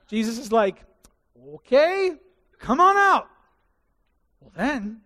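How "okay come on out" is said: high, loud playful call; playful invitation. Acted. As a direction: invite him out cheerfully. Jesus invites Peter onto water.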